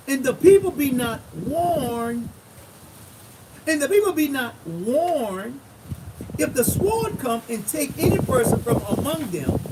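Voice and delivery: emphatic preacher reading scripture; loud, measured Bible reading. Acted. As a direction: proclaim the verse, stretching the key words. Reads scripture aloud in preaching style.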